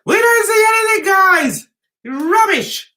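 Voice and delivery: high, loud mock outrage; exasperated rant. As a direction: cry out your complaint to the audience. Exasperated rant about his vlogs.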